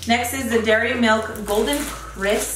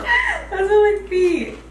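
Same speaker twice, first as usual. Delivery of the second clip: laughing; 9 semitones higher, slower than usual. Real, not acted. Disgusted sniff, laughing.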